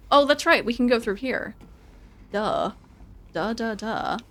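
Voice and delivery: ordinary talk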